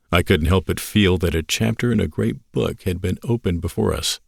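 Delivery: ordinary delivery, nothing marked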